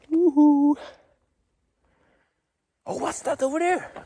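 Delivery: high delighted woohoo; excited whoop. Real, not acted. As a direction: whoop then gasp in wonder. Whoop on spotting something.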